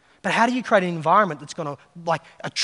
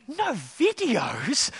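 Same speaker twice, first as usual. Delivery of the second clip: mock disbelief; 5 semitones higher, 3 dB quieter, much slower than usual. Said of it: "he was like".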